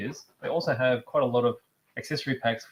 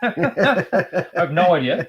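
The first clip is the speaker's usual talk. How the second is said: slow words through a laugh; 4 semitones higher, 7 dB louder, much slower than usual. Real, not acted. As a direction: laugh it out loud. Laughs while admitting.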